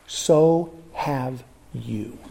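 very slow, low and softened; slow, weighty reveal. Real, not acted. Preacher turning the point on the congregation, slow and grave. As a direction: let each word land, drawn out and hushed.

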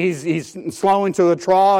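ordinary talk

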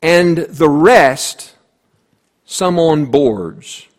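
very slow, weighted reading; slow scripture reading. Shown as a demonstration. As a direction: let each word land slowly. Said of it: "And it says".

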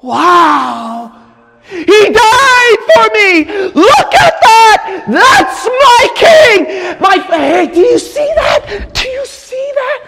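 high, impassioned preacher's shout; overwhelmed outcry. Acted. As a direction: burst out in amazement, pitch soaring. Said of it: "you can't help but go".

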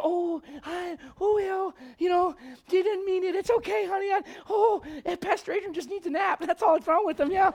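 a parent excusing a misbehaving kid, mock sweet; mock coddling parent. Preacher mocks a parent excusing the child, hammed-up sweet coddling.

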